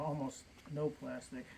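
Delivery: ordinary delivery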